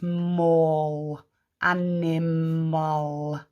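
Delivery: "mull" and "animal" heavily drawn out; "animal" slow, with a long final "mal"; slowed like a snail. Shown as a demonstration. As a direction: stretch "animal" to hear its last syllable. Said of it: "slow it down like a snail".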